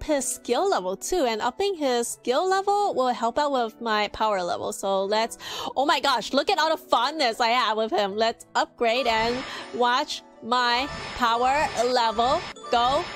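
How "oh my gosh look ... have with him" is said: ordinary delivery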